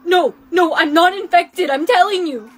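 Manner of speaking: loud, desperate protest; panicked denial. Acted. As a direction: insist loudly you are not infected. Denies infection as removal looms.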